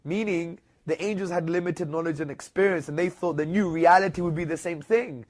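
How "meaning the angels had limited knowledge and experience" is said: ordinary talk, nothing marked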